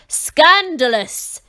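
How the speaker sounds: loud slow outraged shout. A narrator's reading. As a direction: bellow it in outrage. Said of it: "shouted the king".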